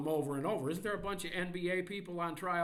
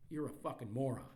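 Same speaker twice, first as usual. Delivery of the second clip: ordinary talk, nothing marked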